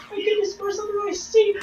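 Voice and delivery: high, soft excited fret; scrambling under seats, high and eager; frantic search. Retold or imitated. Hunting the signed poster.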